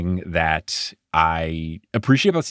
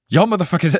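put-on failed New Zealand accent, higher pitched; Steven Adams; 10 semitones higher, 4 dB louder, faster than usual. Podcaster quoting a player to co-host, faltering New Zealand accent. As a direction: attempt a Kiwi accent and miss it. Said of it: "I can't do this in a New Zealand accent".

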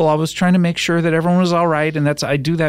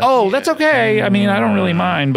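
sugary mock forgiveness; 6 semitones higher than usual. Host excusing being called out, high and falsely gracious. Pretend host brushing off apology.

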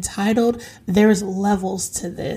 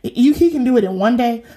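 ordinary talk

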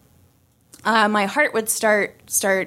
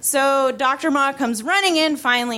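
ordinary talk

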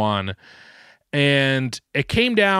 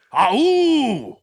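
high laughing wolf howl; 9 semitones higher, 3 dB louder than usual. Shown as a demonstration. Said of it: "here's a wolf".

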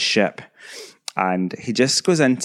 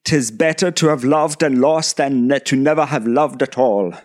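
raised, theatrical verse recital; high-pitched hammy poetic quote in a bad English voice; Patrick Stewart; 4 semitones higher, 4 dB louder than usual. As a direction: declaim it like a melodramatic stage actor. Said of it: "the worst Patrick Stewart ever".

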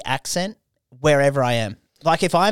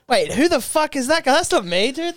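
mocking himself; 12 semitones higher than usual. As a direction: squeak out a shocked double take. Mock reaction to own photo.

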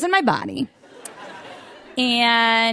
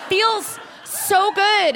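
slow relish; 8 semitones higher, much slower than usual. Real, not acted. Relishing crying.

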